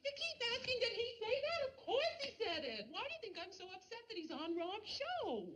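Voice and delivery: high, loud exasperated rush of words; exasperated outburst. Acted. Wife fed up with her friend's questions, exasperated. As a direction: snap back at the repeated question, pitched high.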